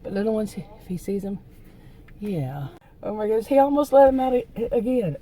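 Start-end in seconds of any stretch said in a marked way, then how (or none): 3.0-5.2 loud, high, rushed real alarm; alarmed exclamation. Real, not acted. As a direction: blurt it out, fast and loud. Dog nearly got let out.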